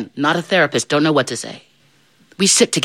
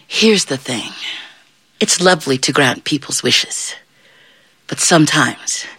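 plain reading voice

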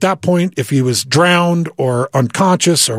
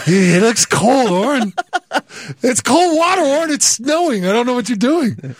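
reluctant whine; 7 semitones higher than usual. Retold or imitated. Reluctant reply to jumping in.

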